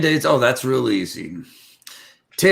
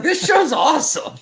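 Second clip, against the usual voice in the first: excited; 13 semitones higher than usual. Retold or imitated. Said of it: "I was like".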